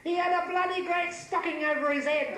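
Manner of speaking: high, exasperated comic squeal; screamed. Acted. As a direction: keep screaming it high-pitched to the last word. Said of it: "screamed Feigelman".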